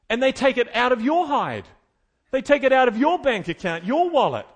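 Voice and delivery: loud, driving sermon build; fired-up preacher rising to a climax; preaching at full force. Acted. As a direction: hammer the point home from the pulpit.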